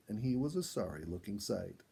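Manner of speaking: ordinary delivery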